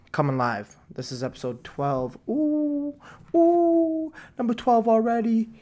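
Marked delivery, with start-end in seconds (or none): 2.3-5.5 squealing excitement. Real, not acted. Excited reaction to episode count.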